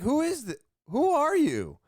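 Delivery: high, loud startled who are you; startled demand. Retold or imitated. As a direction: blurt it out at a stranger who just appeared. Stranger suddenly in driveway.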